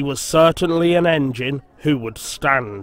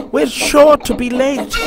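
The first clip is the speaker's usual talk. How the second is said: high, loud frantic engine; frantic panting; 10 semitones higher, 5 dB louder than usual. A narrator's reading. Peter Sam panting to catch Henry. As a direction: pant it out in a panic. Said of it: "panted Peter Sam frantically".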